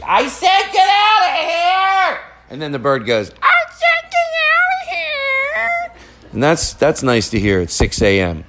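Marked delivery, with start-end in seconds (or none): 0.0-2.2 very high, drawn-out mock yell; mom yelling. Comic imitating mom yelling at dogs, high slow screech. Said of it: "usually she's yelling at dogs". 3.4-6.0 extremely high, slow cockatoo screech; bird mimicking mom. Cockatoo copying mom's yell, squealing bird voice. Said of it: "it mimics my mom, the tone and what she says".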